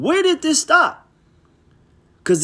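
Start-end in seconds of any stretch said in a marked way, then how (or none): 0.0-1.1 exasperated challenge. Real, not acted. Preacher challenging listeners to find the verse, exasperated. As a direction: pitch jumps up high, demanding an answer.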